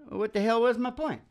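ordinary talk, nothing marked

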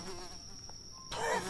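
breathless high squeal; shocked high squeal. Real, not acted.

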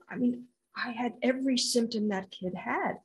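ordinary delivery, nothing marked

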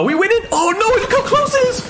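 high-pitched, anxious outcry; alarmed excitement. Real, not acted. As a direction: cry out at the nail-biting score. Alarm at close score.

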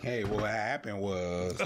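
slow, low, drawn-out lame excuse; mock defendant excuse. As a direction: stall like a defendant with no good story. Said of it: "go to the court and be like".

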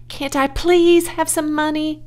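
high-pitched little plea; pleading child. A narrator's reading. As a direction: wheedle a parent. Child begging mother for money.

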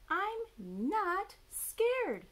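high, slow, drawn-out denial; Baby Owl protesting. A narrator's reading. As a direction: stretch each word like an indignant owlet. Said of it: "said Baby Owl".

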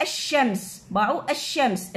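الشمس said a-shams, no lam, doubled sheen; sun letter pronunciation. Shown as a demonstration. Teacher to pupils showing the lam goes silent. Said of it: "من أريد ألفظها".

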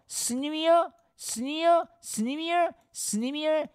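very high, sing-song teasing; hammed-up schoolkid mockery; mocking jeer. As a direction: tease them in a squeaky-high repeated taunt. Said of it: "뭐라고 비웃어?".

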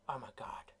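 breathy shocked whisper. Real, not acted. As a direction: whisper it in disbelief. After creeper explosion.

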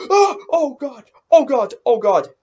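gasping, high, loud panicked cries; jump-scared, cry out in alarm; real fright, voice leaping up; startled fear. Real, not acted. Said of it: "that scared me".

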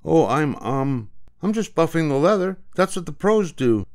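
put-on flustered boy. Mike caught, flustered excuse.

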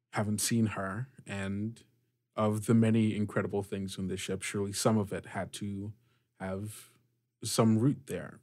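ordinary talk

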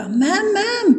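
put-on high, excited child voice; high-pitched kid getting the teacher's attention; child calling teacher. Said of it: "एक बच्चा अपनी ma'am से बोला".

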